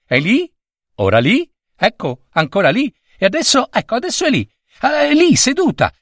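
high, eager little prince; breathless-with-excitement high exclamations; excited pointing-out. A narrator's reading. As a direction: track her aloud, pitch climbing with excitement. Little prince tracks the moving girl.

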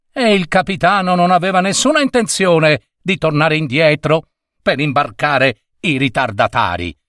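plain reading voice

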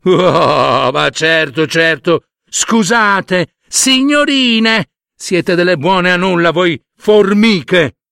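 mock-polite, sneering voice; sarcastic elephant. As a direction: feign courtesy then insult them. (elefante sarcastico con finte scuse)

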